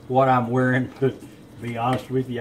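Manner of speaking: normal talking voice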